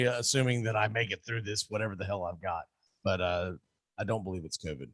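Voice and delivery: under-the-weather croak; sick voice. Real, not acted. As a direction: talk like you're fighting a cold. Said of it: "I'm under the weather".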